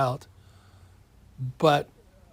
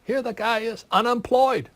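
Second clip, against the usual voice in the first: retold outburst; 8 semitones higher, 4 dB louder than usual. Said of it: "I said".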